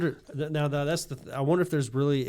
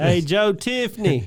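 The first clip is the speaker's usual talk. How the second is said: high drawn-out greeting; 8 semitones higher than usual. Retold or imitated. Mimicking character greeting.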